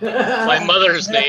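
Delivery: laughing through the words, slowed down. Real, not acted. Laughing as he tells the guys it's his mother's name. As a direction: let the laugh break into each word.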